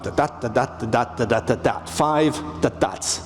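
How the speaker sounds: soft da-da beats showing the iambic pulse; chanting the beat pattern lightly; iambic da-da rhythm. Shown as a demonstration. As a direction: tap out da-DA five times. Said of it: "teach iambic pentameter".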